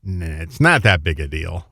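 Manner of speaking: low, soft-ish brush-off; dismissive shrug. Retold or imitated. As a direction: wave it away as nothing. Locals shrug off the horror.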